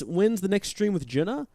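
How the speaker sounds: ordinary talk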